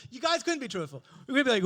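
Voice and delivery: ordinary delivery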